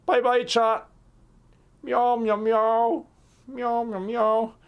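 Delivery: high, cutesy pretend cat voice; voicing the cat. Streamer makes his cat greet chat, in a high cutesy cat voice. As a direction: talk for the cat as if it introduces itself.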